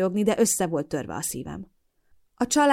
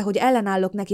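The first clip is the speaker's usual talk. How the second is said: normal talking voice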